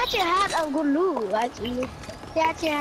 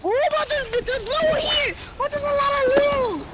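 high eager exclaiming; excited; 8 semitones higher than usual. Real, not acted. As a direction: gush over the loot. Excited over loot.

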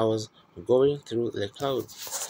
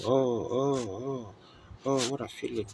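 mournful moaning women. Retold or imitated. Preacher imitating the moaning women in the regions of the lost. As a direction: groan like lost souls in torment. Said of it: "they were going".

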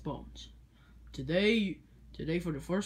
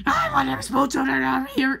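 shouting; 7 semitones higher, 7 dB louder than usual. Acted. Bully student shouting.

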